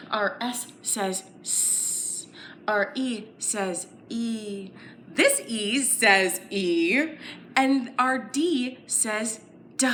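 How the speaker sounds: each letter sound said slowly and apart. Shown as a demonstration. Teacher sounding out the word seed with children. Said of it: "let's sound out our word".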